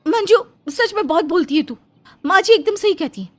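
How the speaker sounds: ऊँची आवाज़ में तुनकती जवान बहू; सास का पक्ष लेकर पड़ोसन को ऊँची आवाज़ में ललकारो; बहू प्रिया की आवाज़. Acted. (पड़ोसन से भिड़ती बहू, ऊँची तीखी आवाज़ में)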